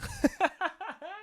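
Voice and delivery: high, soft run of giggles; real laugh. Real, not acted. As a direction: giggle at your own verdict. Laughs after calling person boring.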